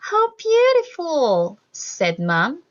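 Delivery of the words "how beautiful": awed. Acted. Mom sees candles at sunset.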